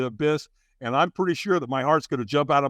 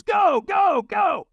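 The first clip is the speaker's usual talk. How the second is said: high, loud coach's command imitated; urgent shouted urging; 5 dB louder, much slower than usual. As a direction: send the trainee charging off. Trainer urging the firewalker on.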